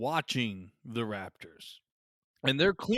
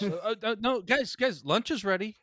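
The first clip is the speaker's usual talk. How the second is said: yelling across the room; 6 semitones higher than usual. Retold or imitated. Imitating Hammond calling guests.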